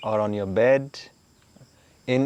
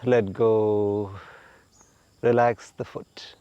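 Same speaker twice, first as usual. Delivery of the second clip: guided relaxation. Acted. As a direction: talk them gently into letting go.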